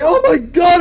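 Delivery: high, loud panicked oh my God; man shocked by pain; panicked exclamation. Acted. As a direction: cry it out in terror. Dirk panics.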